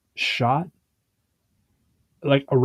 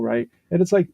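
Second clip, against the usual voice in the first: normal talking voice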